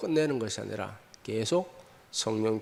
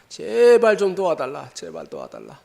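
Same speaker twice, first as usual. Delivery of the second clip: pleading, fading; 4 semitones higher, 8 dB louder than usual. Acted. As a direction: cry out the plea, then let it die away. Woman begging again, acted.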